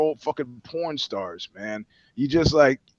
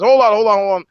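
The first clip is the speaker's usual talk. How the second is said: mock guard call; 5 semitones higher, 7 dB louder, faster than usual. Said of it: "puts his foot in the door and says".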